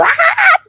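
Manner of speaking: sudden very high shriek; startled shriek. Real, not acted. Gardener startled by a buzzing bee, high shriek. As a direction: squeal as the bee buzzes past.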